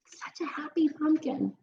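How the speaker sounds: soft unvoiced aside; whisper. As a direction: whisper it to the listener. Aside about the pumpkin.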